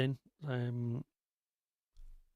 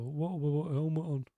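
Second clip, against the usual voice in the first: louder, higher mock of a rough little kid; blunt kid voice; 3 semitones higher, 6 dB louder than usual. Dad showing how his kids really ask, mild blunt kid voice. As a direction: lightly imitate a gruff child demanding. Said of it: "they don't say it like that".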